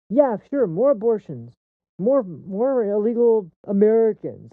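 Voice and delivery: high, loud mocking imitation; bitter sarcasm. Sarcastically voices callous attitude.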